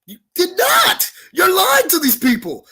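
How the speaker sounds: furious denial. Real, not acted. Wrestler calling his rival a liar to the crowd, slow angry shout. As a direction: drag out NOT in outrage.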